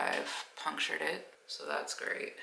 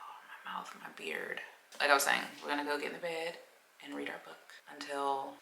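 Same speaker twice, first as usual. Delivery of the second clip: normal talking voice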